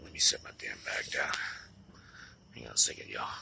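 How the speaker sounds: low whisper under the breath; quick whispered aside to himself. Real, not acted. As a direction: mutter it aside while busy. Aside while putting bag down.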